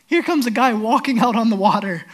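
ordinary delivery

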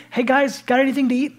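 a cheerful risen Jesus asking for food; high and loud, casually hungry; Jesus voiced warmly. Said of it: "wanted to make it emphatically clear".

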